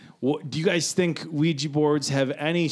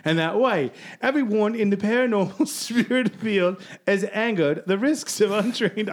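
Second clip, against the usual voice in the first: a light mock British accent, pitched higher; 6 semitones higher than usual. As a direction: read the quote in a hammed-up British accent. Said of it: "I gotta stop using this accent".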